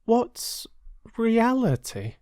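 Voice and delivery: put-on high, curious child's voice; puzzled little girl. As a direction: ask like you've never heard the word. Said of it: "Lily asked, puzzled".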